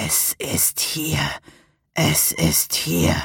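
very slow, drawn-out menace; sinister voice. A narrator's reading. Tormented voice in the narrator's head, slow and sinister. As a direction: intone it slowly like a malicious spirit. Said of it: "Die Stimme klang boshaft und wütend".

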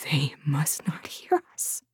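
low, hushed warning; secretive, barely voiced caution; urgent whisper. A narrator's reading. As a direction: whisper it so nobody else hears. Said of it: "the woman whispered".

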